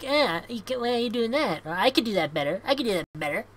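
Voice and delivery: high, nagging mock heckler voice; whiny armchair critic. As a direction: mimic a smug know-it-all. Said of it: "You just sit on the sideline and like".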